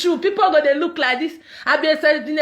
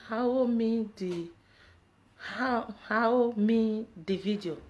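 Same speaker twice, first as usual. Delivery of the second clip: mumbled to self; 6 semitones lower, 11 dB quieter, much slower than usual. Real, not acted. Mumbling a viewer's odd question.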